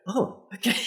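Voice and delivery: mock surprise at the calm request; surprised agreement. Marketing caller Harry taken aback, surprised. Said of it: "And Harry go".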